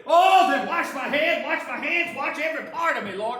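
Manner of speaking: loud, high, stretched-out begging; overeager plea. Acted. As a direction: show off his devotion. Peter overeager reversal.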